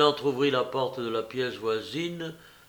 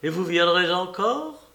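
higher, eager question; joyful; 5 semitones higher, 4 dB louder than usual. A narrator's reading. Patient asking if she will come back, joyful and eager. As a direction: ask with hopeful delight. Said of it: "fit-il joyeux".

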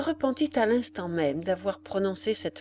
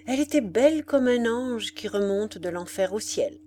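ordinary delivery

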